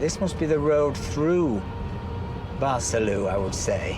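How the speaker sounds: loud, lively guide voice; tour guide commentary. Acted. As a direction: present the village like a guide.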